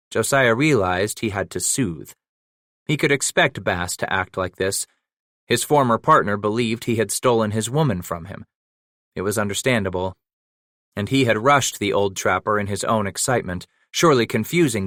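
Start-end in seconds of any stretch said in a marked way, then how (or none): none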